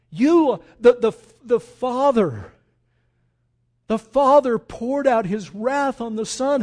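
loud, high fire-and-brimstone preaching; full-force sermon cry; fiery preaching. Acted. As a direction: thunder it at the congregation.

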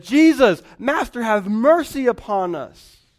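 loud, high plea for mercy; crying out loud. Retold or imitated. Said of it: "they lifted up their voices".

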